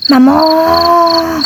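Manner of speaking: put-on little kid wailing for mum and dad, high and far; crying child. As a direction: bawl like a toddler calling for his parents. Small child bursts into crying.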